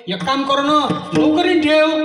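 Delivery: high and loud suggestion; high insistent neighbour. Acted. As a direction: push the idea eagerly. Neighbour advising.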